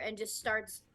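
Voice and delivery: ordinary talk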